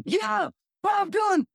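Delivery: high, slow shouted name; mock caller. Producer on the phone announcing [name], high slow shout. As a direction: call out the name like big news.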